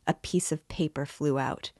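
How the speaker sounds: plain reading voice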